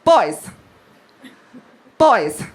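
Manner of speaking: imitated mum calling her children in; mock-commanding call, each summons set apart; Linda. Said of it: "old Linda, and she's going".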